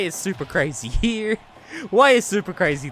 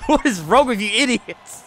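usual speaking voice

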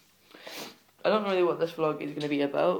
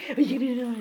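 much higher, squeaky teasing of the dog; 8 semitones higher, 3 dB louder, much faster than usual. Pretend. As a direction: taunt the dog in a silly high voice. Teasing dog after tug win.

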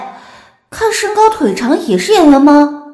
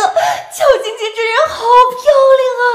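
high, laughing gush over a star; excited gushing; 8 semitones higher than usual. Acted. Starstruck fan gushing online about seeing Qiao Jingjing, excited and laughing.

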